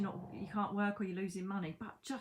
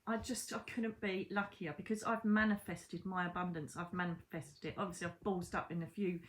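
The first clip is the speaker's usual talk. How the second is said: ordinary talk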